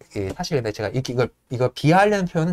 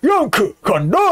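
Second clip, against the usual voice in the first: higher, hammed-up stoker cry; mock Yank shout; 6 semitones higher, slower than usual. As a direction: announce yourself like a brute. Yank shouting while shoveling. (과장된 양크의 외침)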